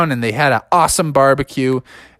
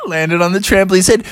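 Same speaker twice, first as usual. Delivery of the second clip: plain reading voice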